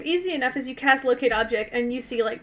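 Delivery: ordinary talk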